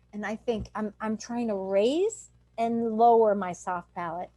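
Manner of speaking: usual speaking voice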